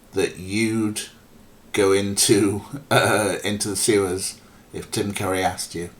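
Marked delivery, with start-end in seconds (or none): none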